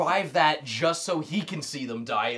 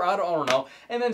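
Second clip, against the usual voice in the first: ordinary talk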